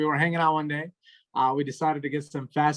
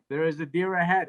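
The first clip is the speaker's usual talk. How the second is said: ordinary delivery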